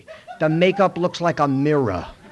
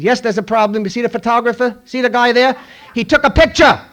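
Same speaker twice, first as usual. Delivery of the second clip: louder, higher indignant protest; indignant excuse; 7 semitones higher, 10 dB louder than usual. Retold or imitated. Actor blames the photographer to the director, loud indignant.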